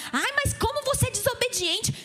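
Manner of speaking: exasperated scold pitched well up; mock scolding. Pastor imitating parents scolding a disobedient child, exasperated. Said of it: "você virar".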